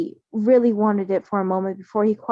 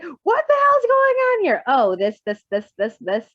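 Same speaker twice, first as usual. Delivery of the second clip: chill uncle impression; 6 semitones higher than usual. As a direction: play the lazy uncle baffled by chaos. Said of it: "that chill-ass uncle in the corner".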